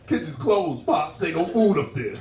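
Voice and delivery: mild mock bouncer; dismissive bouncer. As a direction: brush off the old guy at the door. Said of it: "the bouncer's like".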